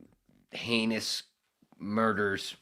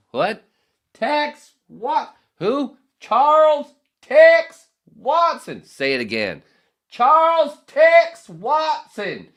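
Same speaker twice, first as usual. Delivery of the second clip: very high, full-volume back-and-forth yelling; incredulous shouting; 19 dB louder, much slower than usual. Acted. Acting out people learning the killer's name, shouted disbelief. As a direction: yell the name out, stretched word by word.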